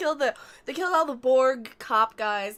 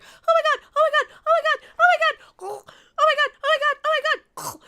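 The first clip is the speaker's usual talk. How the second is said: much higher, louder, frantic oh-my-gods; panicked Renee impression; 6 dB louder than usual. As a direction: squeal it over and over like she's losing it. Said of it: "you remember the one who's like".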